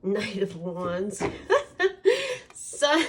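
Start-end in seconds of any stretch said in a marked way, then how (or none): 1.3-2.4 sudden high squeal of a laugh; a real giggle bursting out, pitched way up; burst of laughter. Real, not acted. Reacting to a drawn card.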